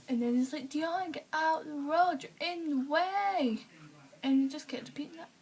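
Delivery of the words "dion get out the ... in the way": higher, louder and slow; insistent child ordering someone off the road; bossy little cousin. Retold or imitated. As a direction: drag out each word like a stubborn kid.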